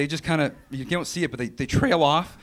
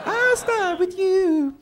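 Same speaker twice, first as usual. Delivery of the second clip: high, loud, long-drawn words; hammed-up character voice; 5 dB louder, much slower than usual. Closing the mock handicap ad, high, drawn-out put-on voice. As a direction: stretch each word out high and loud. Acted line ending mock ad.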